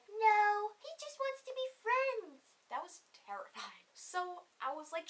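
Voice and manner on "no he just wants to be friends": high, drawn-out naive little voice; little innocent voice. Retold or imitated. Her innocent side defending the Tinder date, high naive voice. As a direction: plead it high and sweetly, like a hopeful innocent. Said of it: "the little innocent part of me".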